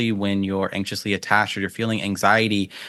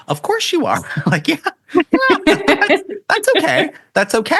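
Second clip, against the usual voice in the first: much higher, louder, laughing through it; laughing reassurance; 5 dB louder than usual. Real, not acted. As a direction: laugh it off for them, high and bright with delight. Laughing reassurance of anxious listener.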